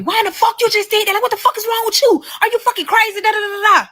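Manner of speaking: high-pitched, rapid imitation of a man yelling; mock yelling. Woman mimics her child's father after the wreck, mock yelling. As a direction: rattle off his outraged questions way up high. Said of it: "So he yelling at me".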